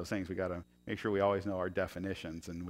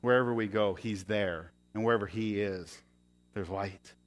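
ordinary talk, nothing marked

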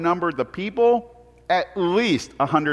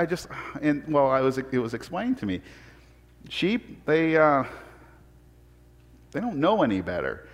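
usual speaking voice

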